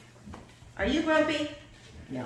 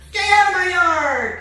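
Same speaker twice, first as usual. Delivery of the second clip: very high, full-out grumpy shout; 12 semitones higher, 15 dB louder than usual. Shown as a demonstration. Reader shows children how Hank shouts, shouting. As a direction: shout it like a fist-shaking grouch. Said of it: "He shouts like this with the fist".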